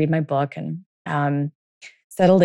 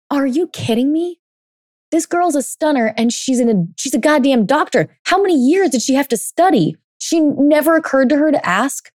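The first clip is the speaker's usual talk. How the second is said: higher and louder, building disbelief; incredulous; 7 semitones higher, 3 dB louder than usual. Real, not acted. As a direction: push the outrage up line by line. Indignant rant about the doctor.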